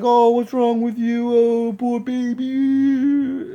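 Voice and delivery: high, loud, drawn-out fake pity; mocking baby talk. Corpsmen making fun of a hunched-over patient, mocking. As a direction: stretch it out in a high mocking whine. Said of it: "starts making fun of me".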